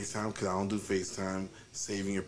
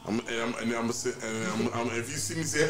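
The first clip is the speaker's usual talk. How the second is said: usual speaking voice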